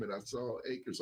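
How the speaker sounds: normal talking voice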